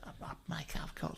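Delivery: unvoiced, hushed aside; whispered aside. Whispers to his mate as a scheming pensioner. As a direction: drop to a secret whisper. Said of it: "whispered aside in the story".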